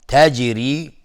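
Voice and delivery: تجری said with strong qalqala on the jim; qalqala demo. Shown as a demonstration. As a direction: pop the ج in تجری so it rebounds.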